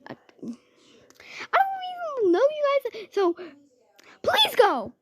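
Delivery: high-pitched acted begging; frightened pleading. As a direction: plead in a high, desperate squeal.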